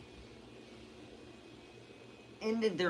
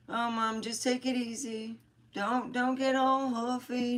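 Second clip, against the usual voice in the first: higher, lightly voiced son telling mom to calm down; son's teasing; 9 semitones higher than usual.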